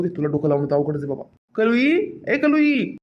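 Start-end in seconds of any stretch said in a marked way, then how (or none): 1.5-3.0 calling out. Acted. Master calling out to servant.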